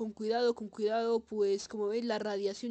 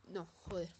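cursing under breath; 4 semitones lower, 10 dB quieter than usual. Real, not acted. Dies again.

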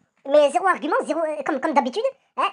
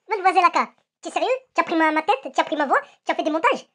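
usual speaking voice

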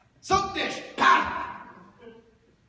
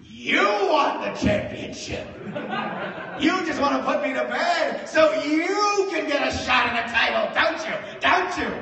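loud, much higher, shouting; drunk accusation; 10 semitones higher, 7 dB louder than usual. Retold or imitated. Drunk friend accusing the comic in his face, mock angry.

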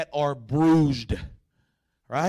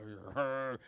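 long, low, soft growl; imitated dog growl; 8 semitones lower, 13 dB quieter than usual. Dog answers the nice-doggy pleading, long low growl. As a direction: draw out a quiet rumble like a dog.

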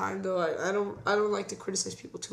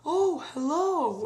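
higher, slow, sweet greeting; Foley as polite host; 8 semitones higher, much slower than usual. Retold or imitated. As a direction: play the cosy host at the door. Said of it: "he go".

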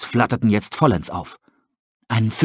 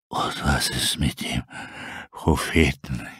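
very low, slow breathy wolf rasp; a dying wolf barely whispering; weak wolf; 10 semitones lower, 7 dB quieter, slower than usual. Acted. Starving wolf asking about his prophet, faint rasp.

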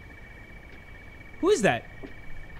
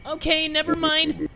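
high, put-on backing-down voice; wimpy kid; 6 semitones higher than usual. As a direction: back off in a wimp's high voice. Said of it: "I made him sound like a little bitch".

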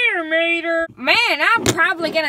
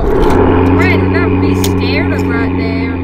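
ghost voice; 11 dB louder than usual. Acted. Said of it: "another part of the ghost tape".

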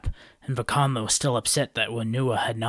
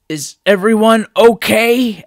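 anxious call; 11 semitones higher, 13 dB louder than usual. A narrator's reading. Vakama under the heap.

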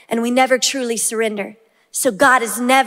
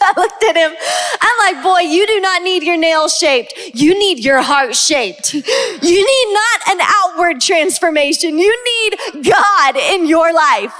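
laughing while preaching; 4 semitones higher than usual. Real, not acted. Mother retelling her son's reply, laughing.

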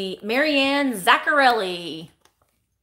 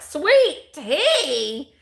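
higher-pitched, exaggerated teasing quote; mocking imitation; 5 semitones higher, 4 dB louder than usual. Rude Chicago restaurant staff teasing her order, mocking. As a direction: imitate someone making fun of the customer. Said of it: "making fun of me".

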